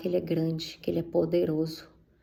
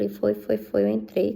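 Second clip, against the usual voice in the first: usual speaking voice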